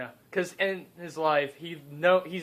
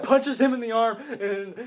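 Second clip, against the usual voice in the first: excited fight narration; 6 semitones higher, 3 dB louder than usual. A narrator's reading.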